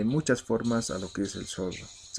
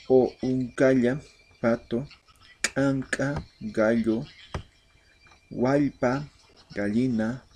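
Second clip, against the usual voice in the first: pronunciation drill; 2 semitones higher than usual. Shown as a demonstration. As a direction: call out every word clearly for the class to repeat. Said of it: "un repaso para la pronunciación". (repaso de pronunciación, palabra aymara más aguda y fuerte)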